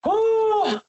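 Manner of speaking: a sudden high-pitched whew of frustration; high whoop. Real, not acted. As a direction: let out a sharp, very high whew. Fired-up rant about the game.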